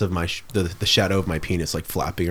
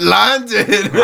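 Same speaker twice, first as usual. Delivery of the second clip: laughing; 13 semitones higher, 6 dB louder than usual. Retold or imitated. Repeating the meme, laughing.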